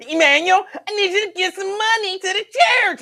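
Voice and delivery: very high, loud comic impression; Lincoln Ware. God asking for church money, high loud Lincoln Ware voice. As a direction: push it up high and belt it out. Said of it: "what if God sound like Lincoln Ware".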